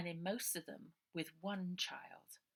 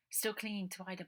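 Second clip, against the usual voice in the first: usual speaking voice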